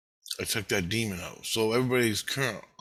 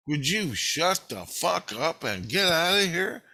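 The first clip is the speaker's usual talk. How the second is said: slow, high-pitched fed-up demand; retold exasperation; 6 semitones higher, slower than usual. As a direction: stretch out each word in exasperation. Retells telling priestess to leave.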